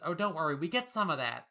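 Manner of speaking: ordinary delivery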